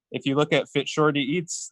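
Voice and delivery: ordinary talk, nothing marked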